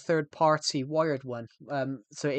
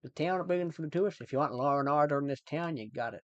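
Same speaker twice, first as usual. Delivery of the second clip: put-on Irish accent. Shown as a demonstration. Streamer shows chat cowboy-film line, Irish accent. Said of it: "I can do Irish pretty well".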